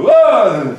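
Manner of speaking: loud high mock yell of delight; retold happy yell. Teacher acting his own yell for the class. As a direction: belt out a big excited whoa. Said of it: "a happy yell or an angry yell".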